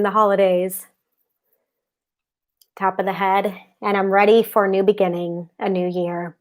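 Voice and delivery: calm, steady affirmation voice; guided tapping. Acted. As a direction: recite each tapping point soothingly. Leads tapping script aloud.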